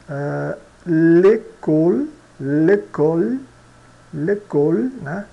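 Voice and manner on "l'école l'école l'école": l'école said slowly, ending on the L with no final E; silent final E. Shown as a demonstration. Teacher drilling the silent final E. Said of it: "O E não pronunciado".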